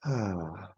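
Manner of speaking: soft, low sighed-out "ah"; exhaling "ah". Shown as a demonstration. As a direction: let the breath fall out on a quiet ah. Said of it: "And just fully exhaling".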